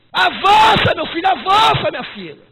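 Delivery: loud, high cry; shouted exhortation. Acted. Pastor urging his son in faith forward, loud shout. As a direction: throw the word out to the church. Preaching at full force.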